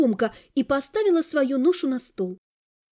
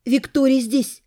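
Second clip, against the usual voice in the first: usual speaking voice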